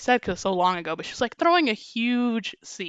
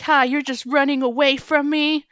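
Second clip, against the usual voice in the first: higher, louder scornful taunt; mock jeer; 5 semitones higher, 4 dB louder than usual. Retold customer mocking the retreating cashier, jeering. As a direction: laugh at her as she leaves.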